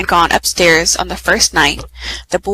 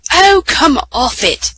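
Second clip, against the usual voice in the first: put-on tired boy's higher, drawn-out grumble; bad-tempered; 7 semitones higher, 4 dB louder than usual. Edmund, tired and pretending not to be, bad-tempered. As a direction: scoff it louder, stretching the words. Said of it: "always made him bad-tempered".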